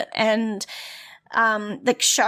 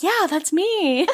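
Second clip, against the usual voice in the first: bashfully delighted; 6 semitones higher, slower than usual. Pretend. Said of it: "And I'd be like".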